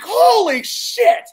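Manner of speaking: high, slow startled exclamation; retold shock at a sudden light; startled cry. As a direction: jump out of your skin. Light flashes in his face.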